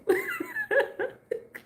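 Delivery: squealing burst of laughter; high laugh. Real, not acted. Laughing at a viewer's remark, high squeal. Laugh after if you only knew.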